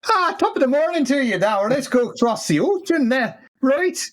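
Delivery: high, fast hammed-up Irish accent; mock Irish accent. Host jokingly answering with a bad Irish accent. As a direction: rattle it off like a stage Irishman. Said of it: "Apparently, I can't either".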